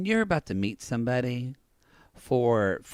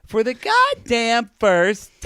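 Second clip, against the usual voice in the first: exasperated, building to a loud finish; exasperated rant; 7 semitones higher, 3 dB louder than usual. Real, not acted. Co-host ranting about chewing gum before meeting someone, exasperated. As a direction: rant it, hammering the last words louder.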